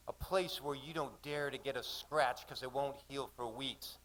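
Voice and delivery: usual speaking voice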